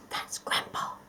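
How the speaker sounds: soft breathy whisper. Acted. The cat whispers to the toy about the dozing old man. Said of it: "whispered the cat to Nothing".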